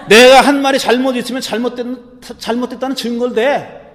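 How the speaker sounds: acted defiant comeback; talking back. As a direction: demand proof from your accuser. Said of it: "이렇게 대들었어요".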